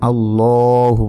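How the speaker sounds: slow chanted reading; recited word. Shown as a demonstration. Teacher recalls the heavy Allahu for students, slowly chanted. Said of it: "লা না বলে আমরা কি বলেছিলাম?".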